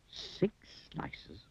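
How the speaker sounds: hushed, drawn-out whisper. A narrator's reading. Narrator reveals the last of the breakfast list, whispered. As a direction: whisper it like a secret.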